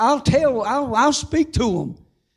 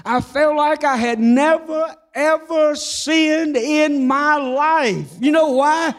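higher, slow, fervent preaching; fervent testimony; 5 semitones higher, slower than usual. Acted. Preacher testifying to the church, higher and fervent. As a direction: lift it up with joy.

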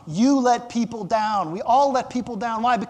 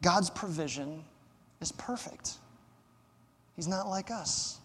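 quiet, reverent close with pauses; hushed conclusion; 3 semitones lower, 11 dB quieter than usual. Real, not acted. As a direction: drop to a soft, low near-whisper.

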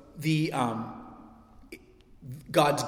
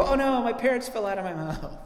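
higher, mock horrified gasp; mock dismay; 8 semitones higher than usual. Preacher imitating a grown child in mock dismay. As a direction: play a grown kid's horror. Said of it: "one day you're gonna go".

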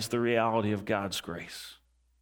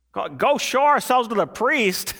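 incredulous; 8 semitones higher, 4 dB louder than usual. Acted. Lepers' incredulous reply acted.

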